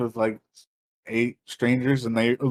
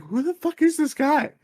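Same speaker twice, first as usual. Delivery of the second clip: very high mock-puzzled question; baffled dancers; 12 semitones higher than usual. Dancers chatting over the stranger mid-dance, high and baffled. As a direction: imitate dancers wondering aloud, pitched way up. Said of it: "they're literally talking to".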